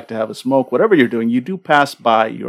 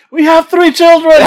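high, loud hammed-up protest; comic anguished shout; mock outraged yell; 13 dB louder than usual. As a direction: shriek it like a soap-opera spouse. Jilted lover in prank scene.

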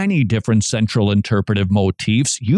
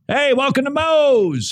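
high, hammed-up welcome holler; mock yelled greeting from the counter; greeter's yell; 12 semitones higher than usual. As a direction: call out a cheery restaurant welcome. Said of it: "people yell at you".

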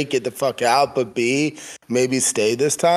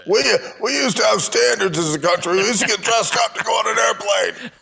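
mock indignant rant; 9 semitones higher than usual. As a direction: rant like a grumpy traditionalist.

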